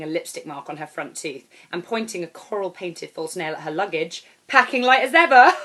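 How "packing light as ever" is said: put-on high, loud, brassy holiday girl; brash loud woman. Airport stranger hailing the narrator, high and loud. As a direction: belt it out like a brash party girl.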